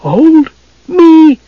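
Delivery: very high, stunned squeak; thunderstruck. A narrator's reading. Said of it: "Tass was thunderstruck".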